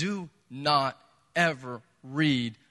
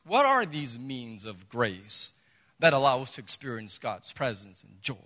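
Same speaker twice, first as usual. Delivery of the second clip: normal talking voice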